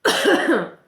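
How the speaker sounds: loud real coughing; harsh hacking cough; coughing fit. Real, not acted. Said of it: "j'arrête pas de tousser".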